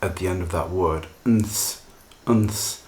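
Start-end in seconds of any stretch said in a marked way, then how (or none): 1.2-2.8 "nths" said slowly with the th kept clear; nths cluster. Shown as a demonstration. Teacher modelling the tricky ending: careful nths. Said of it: "things like that are very difficult for many people to pronounce".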